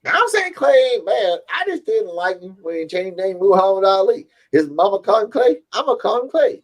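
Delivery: put-on high, cranky old barbershop man voice; old barber impression. Friend imitating the old Coming to America barber, high and cranky. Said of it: "that's what I'm talking about".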